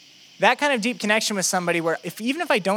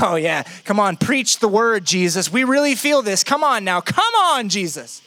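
hyped-up crowd egging on the preacher; mock eager amen corner, jumping in pitch; cheering disciples. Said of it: "his disciples would be like".

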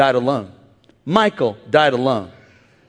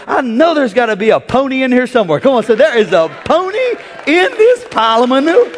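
louder and higher, building to a shout; rising preach shout; 7 semitones higher, 4 dB louder than usual. Acted. Preacher to the church, building to a shout.

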